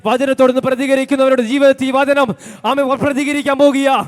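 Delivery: rising proclamation to the church; fervent preaching. Real, not acted. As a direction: urge them on like a revival preacher. Preaching at full force.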